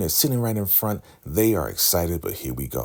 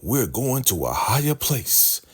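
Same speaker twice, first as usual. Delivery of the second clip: high, declamatory preacher imitation; prophetic proclamation; 9 semitones higher than usual. The Highest revealing the prophecy to followers, proclaiming. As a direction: announce it grandly to the flock.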